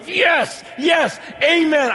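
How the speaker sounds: high, loud shout of yes amen. Retold or imitated. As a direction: cry out yes amen in agreement. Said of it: "I wanted to scream".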